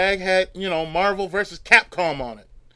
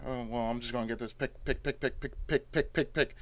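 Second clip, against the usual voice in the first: soft, lower mumbled picking; quiet; 7 semitones lower, 12 dB quieter than usual. Retold or imitated. Shopper sneaking treats into the cart, soft murmur. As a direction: murmur it to yourself.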